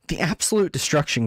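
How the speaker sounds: usual speaking voice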